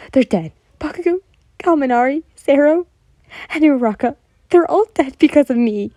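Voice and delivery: loud high sobbing wail. A narrator's reading. Yen naming the dead friends to Todoroki, loud sobbing. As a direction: wail the names through tears. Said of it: "she continued sobbing".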